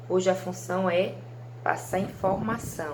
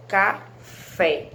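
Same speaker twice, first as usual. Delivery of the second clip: slow "café", a gap before a strong "fé"; stress on final syllable; 5 dB louder than usual. Shown as a demonstration. Teacher demonstrating tonic syllable to pupils, "café" split with "fé" stressed. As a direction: break "café" in two and land hard on "fé". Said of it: "A sílaba que vocês falam com mais intensidade".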